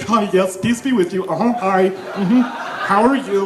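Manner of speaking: lightly voiced smiling churchgoer; a hint of chirpy summer-camp greeter; permanently smiling. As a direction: put on a fixed, pious smile. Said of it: "It's just permanently smiling".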